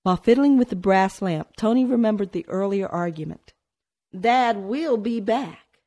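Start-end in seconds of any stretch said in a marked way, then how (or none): 4.2-5.8 slow, lightly voiced boy with a grimace; grimacing boy. A narrator's reading. Tony insists to his sister that Dad returns, slow and grimacing. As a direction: drag it out through a grimace. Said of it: "he grimaced".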